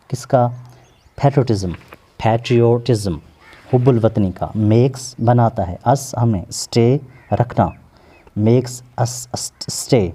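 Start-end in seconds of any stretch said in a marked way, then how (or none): none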